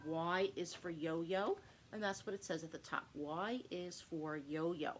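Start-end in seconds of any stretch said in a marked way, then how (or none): 3.1-4.9 slow, spelled-out teaching phrase; slow and clear. Shown as a demonstration. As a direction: say it slowly for a child to copy. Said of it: "slow modelled phrase for child".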